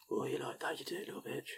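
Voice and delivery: hammed-up sleazy murmur at the glass; mock dirty talk. Host mixing his drink, mock sleazy murmur.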